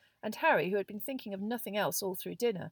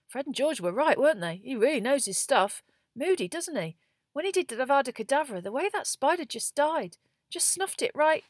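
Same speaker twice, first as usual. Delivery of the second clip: high, louder, gushing chatter; excited; 7 semitones higher, 7 dB louder than usual. A narrator's reading. Ron raves to Harry about the lesson, excited. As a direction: rave to your friend about it.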